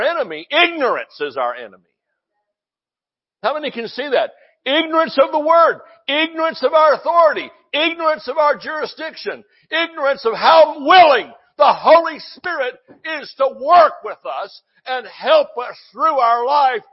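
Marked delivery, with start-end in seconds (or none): 6.1-16.8 rising fervent sermon cry; preaching shout. Real, not acted. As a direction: call it out to the whole church. Preacher building a list of ignorances.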